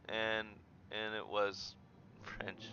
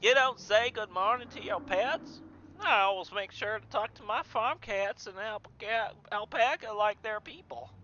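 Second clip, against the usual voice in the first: put-on high, chatty Southern-accented lady; Southern woman; 9 semitones higher than usual. Cleaning lady talking about her farm cats, high Southern accent. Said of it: "Southern woman, I guess?".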